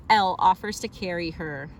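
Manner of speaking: plain reading voice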